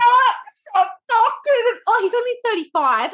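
high, loud thrilled squeal over a crush; excited squeal. Real, not acted. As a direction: gush out loud, unable to contain it. Squealing over the hot priest.

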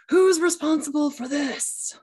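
high, loud accusing mom shout; angry mom. Retold or imitated. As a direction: storm in demanding who did it. Mom storming into room.

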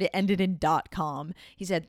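ordinary talk, nothing marked

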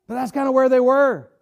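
usual speaking voice

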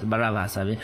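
ordinary talk

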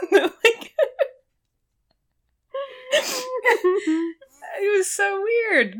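helpless high-pitched laughter through slow words; laughing. Real, not acted. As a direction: squeak it out while cracking up. Cracking up over the episode.

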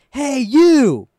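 high, loud shout of hey you; retold biker yell. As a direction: bellow it down the street at the kid. Biker yelling from down street.

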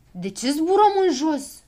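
alarmed cry; anxious little men asking why they are sinking; worried. A narrator's reading. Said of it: "se neliniștiră prichindeii".

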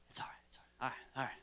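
soft whispered reassurance. Real, not acted. Host whispering reassurance to the volunteer. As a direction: breathe it to him. Calming amid the noise.

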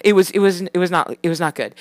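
usual speaking voice

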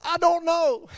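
high, loud comic outburst; exasperated admission. Acted. Preacher answering his own challenger, comic loud exasperation. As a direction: throw it out high and loud for a laugh.

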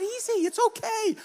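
put-on calm her down like a proud kid; reassuring kid. Kid calming screaming teacher.

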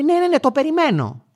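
high, dismissive "yes, yes, yes"; impatient woman. Retold or imitated. Mother waiting for her child brushes off the driver, high. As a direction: brush him off, bored and high.

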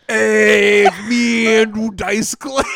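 loud brash comic swagger; Andrew Dice Clay. Acted. Host introducing himself at the mic, brash and loud. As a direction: announce yourself like a brash stand-up. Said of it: "I don't do that voice".

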